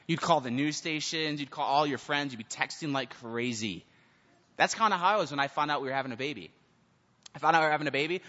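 usual speaking voice

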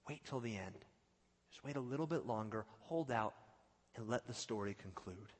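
quiet, steady and unhurried reply; calm reassurance. Acted. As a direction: gently counsel patience, voice dropped. Voices God's calm reply after outburst.

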